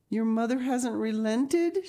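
high-pitched anxious gasp; gasped question. A narrator's reading. Anne asks her friend about the mother, high gasped question. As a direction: gasp it, rising high in dismay. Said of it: "she gasped".

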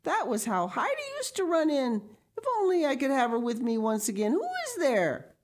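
put-on high, agitated old grandmother's cry. A narrator's reading. Grandmother in the corner longing for Heidi, crying out. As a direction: cry out high, drawing out the last question. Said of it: "cried the voice from the corner".

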